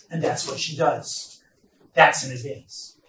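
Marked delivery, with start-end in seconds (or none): none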